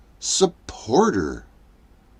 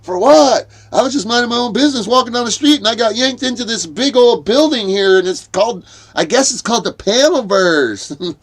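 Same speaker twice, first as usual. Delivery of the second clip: higher, rushed indignant pleading; whiny protest; 8 semitones higher, 3 dB louder than usual. Retold or imitated. Pulled in off the street, pleading his innocence in a high rush. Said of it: "And I'm like".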